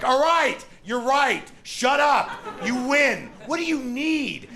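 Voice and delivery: high, exasperated yelled surrender; hammed-up high yell of giving in; giving-up scream. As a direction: shout it out to make them stop. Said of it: "I'd be just screaming".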